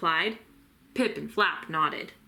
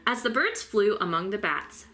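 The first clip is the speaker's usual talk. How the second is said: ordinary delivery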